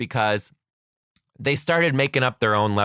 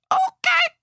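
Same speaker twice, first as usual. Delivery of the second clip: squeaky high okay, jolted; a startled yelp of okay; shocked okay; 4 dB louder than usual. Real, not acted. Shocked reaction to kid's idea.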